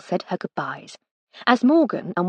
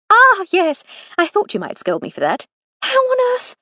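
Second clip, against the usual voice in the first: higher, louder mock innocence; Lady Hardcastle, caught out; 13 semitones higher, 6 dB louder than usual. Lady Hardcastle caught out over the torn dress, higher, mock innocent. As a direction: feign surprise at the scolding.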